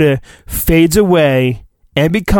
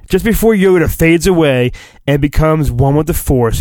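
normal talking voice

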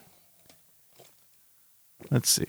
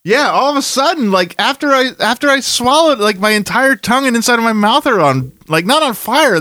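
excited; 9 semitones higher than usual. Real, not acted. Reacting to the hot chip.

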